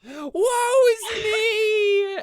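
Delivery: loud, high theatrical wail; wailing arrow. Acted. Game master voices arrow flying at its target, hammed-up wail. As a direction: cry it out like a doomed arrow in flight. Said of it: "you just hear".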